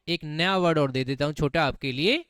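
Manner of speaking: usual speaking voice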